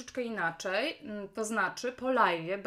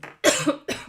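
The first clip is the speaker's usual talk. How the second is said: loud rough cough; real coughing fit; chesty cough; 4 semitones higher, 10 dB louder than usual. Real, not acted.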